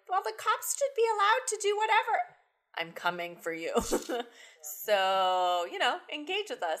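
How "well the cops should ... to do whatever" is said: high, put-on snooty lady. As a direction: sneer it like a prim lady. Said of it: "going like".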